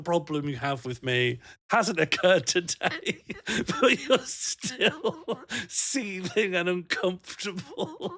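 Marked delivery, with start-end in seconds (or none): 1.7-8.1 high and slow, laughing through the words; amused teasing of a sulking friend. Real, not acted. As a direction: tease him while giggling. Teasing chatter while laughing.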